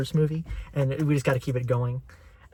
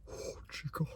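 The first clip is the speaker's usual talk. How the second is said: whisper; 15 dB quieter than usual. Retold or imitated. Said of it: "so he's gonna be like".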